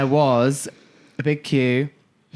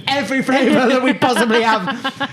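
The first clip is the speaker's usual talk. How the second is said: high, louder, laughing eagerness; a starstruck bartender gushing at Alan Rickman; giddy eager reply; 6 semitones higher, 3 dB louder than usual. Retold or imitated. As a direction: gush it out, thrilled and laughing. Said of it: "I was like".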